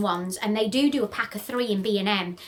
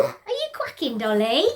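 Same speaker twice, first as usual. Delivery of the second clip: pet voice; 3 semitones higher than usual. Real, not acted. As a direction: tease the duck sweetly. Talking to duck Dolly.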